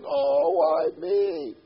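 slow, high, loud self-pitying wail; mock the immature believer whining; whiny complaint. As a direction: moan like a sulking victim. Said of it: "the immature person says".

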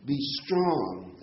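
STRONG leaned on and drawn out slowly; slow, weighty emphasis on "strong"; stress on STRONG. Real, not acted. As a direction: lean hard on "strong", taking your time. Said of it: "I wanna emphasize the word strong".

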